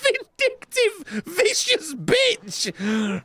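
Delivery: strained, high-pitched outburst; outraged cursing. Real, not acted. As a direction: drag the insult out in a high, strained cry. Hurt by game foe, swears at it.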